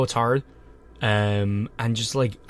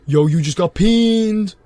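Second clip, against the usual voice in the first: prank show host; 7 semitones higher, 7 dB louder, much slower than usual. Pretend. Prank show reveal catchphrase.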